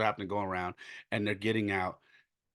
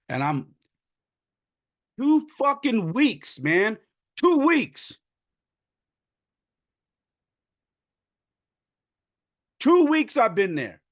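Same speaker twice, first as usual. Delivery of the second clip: high, loud, choked-up cry of disbelief; real tearful outburst; 12 semitones higher, 6 dB louder than usual. Real, not acted. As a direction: let the words burst out through tears, pitch way up. Overcome retelling kids' reaction.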